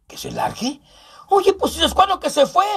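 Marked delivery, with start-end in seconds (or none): 0.1-0.8 low whispered echo. Acted. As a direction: breathe it back in a hushed aside. Whispered echo of own line.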